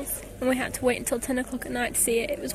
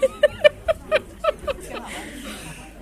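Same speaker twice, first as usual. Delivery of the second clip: high laugh; 5 dB louder than usual. Real, not acted. Laughs at her own remark.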